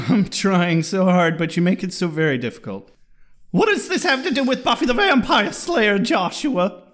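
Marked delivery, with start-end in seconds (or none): 3.5-6.8 high, puzzled mom voice. Pretend. Host voices his mom questioning the show, high. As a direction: mom baffled by her son, high.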